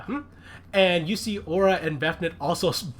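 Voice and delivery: usual speaking voice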